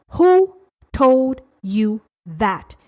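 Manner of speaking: each word drawn out on its own; very slow, spaced-out questioning; slow, emphatic challenge. Real, not acted. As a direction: demand it slowly, word by word. Challenges listener, repeats question slower.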